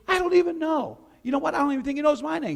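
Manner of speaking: high, bright burst of surprise; eager cheerful confession; excited realization. Acted. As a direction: blurt it out like a happy discovery. Healed man excited realization.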